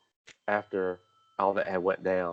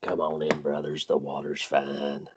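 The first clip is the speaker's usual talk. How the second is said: mock preacher; 5 semitones lower, 4 dB quieter than usual.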